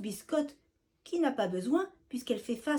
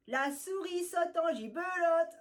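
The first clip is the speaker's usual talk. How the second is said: gleeful retort; 7 semitones higher than usual. Acted. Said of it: "répond un autre".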